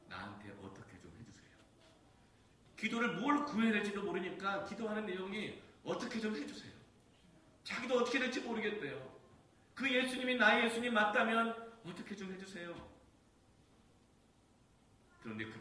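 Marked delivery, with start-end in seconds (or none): 2.7-5.6 high, loud preaching; fervent preaching. Acted. Preacher at full force. 5.8-6.7 high, loud desperate plea "어떻게 좀 해주세요"; desperate cry. Acted. Desperate prayer cried out. 7.6-9.2 high, loud preaching; fervent preaching. Acted. Preacher at full force. 9.7-12.9 high, loud desperate plea "어떻게 좀 해주세요"; desperate cry. Acted. Desperate prayer cried out.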